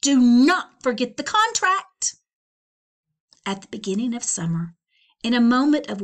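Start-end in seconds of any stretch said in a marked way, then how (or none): 0.0-2.1 loud stern call; mother shouting. Acted. Mother reminding Flora of the contract, loud shout. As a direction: bellow the reminder. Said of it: "her mother shouted".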